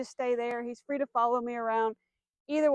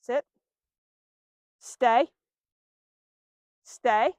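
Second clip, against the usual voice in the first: louder firm stay; 7 dB louder, much slower than usual. Shown as a demonstration. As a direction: set the command down firmly. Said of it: "in a firm tone".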